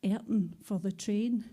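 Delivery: rhythmic spoken-word recital in Scots; punchy, rhythmic poem delivery; poem performance. Acted. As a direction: perform it like a live poetry slam.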